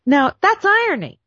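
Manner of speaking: high loud exclaim; excited burst on irony; emphatic punchline. Real, not acted.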